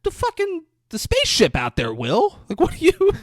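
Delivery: high, loud mock-exasperated outburst; upset wife. Wife to husband about the spaceship, exasperated mock yell. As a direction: imitate an incredulous wife blowing up. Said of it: "she's really upset".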